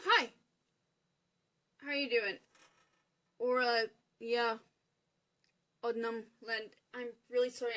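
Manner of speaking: ordinary delivery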